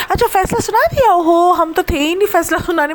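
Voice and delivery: high, fake-surprised sarcasm; mock surprise. Vlogger mocks the vloggers' fake surprise, high sarcastic. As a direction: ham up pretend shock.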